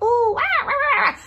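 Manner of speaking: high-pitched imitated wail; mock tantrum squeals, very high; mock child wailing. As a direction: squeal like a fussing kid or small dog. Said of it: "like I'm talking to my sister's kids".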